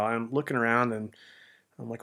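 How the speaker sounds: normal talking voice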